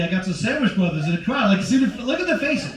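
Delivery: ordinary delivery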